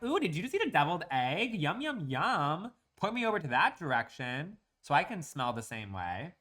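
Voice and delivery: high, sing-song mock-delighted voice. Mocking imaginary picnic guest, fake delighted. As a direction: gush sarcastically over the smell. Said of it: "out here being like".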